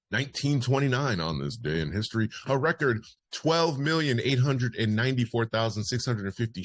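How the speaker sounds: punchy, projected history bulletin; news read. Acted. Host reads listeners this day in history, news read. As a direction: announce it like a radio newsreader.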